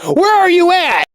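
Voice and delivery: imitated high-pitched angry scream. Woman demanding where the phone finder is, screaming. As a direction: yell it high, demanding an answer. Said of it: "She then screams back on the phone".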